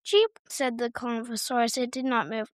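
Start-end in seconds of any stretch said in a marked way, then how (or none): 0.0-0.4 a high, louder little chirp; tiny dinosaur cheep. Acted. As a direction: chirp back like a stubborn baby dinosaur. Dinosaur answers Ollie.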